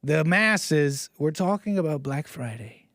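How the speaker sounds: usual speaking voice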